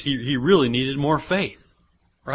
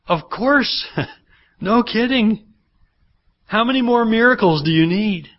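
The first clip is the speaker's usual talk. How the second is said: higher pitched, teasing disbelief; mock exasperation; 8 semitones higher, 3 dB louder than usual. Pastor teases the disciples about needing miracles, mock exasperated. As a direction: roll your eyes at the obvious.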